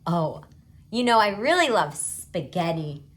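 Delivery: usual speaking voice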